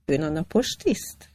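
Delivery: ordinary delivery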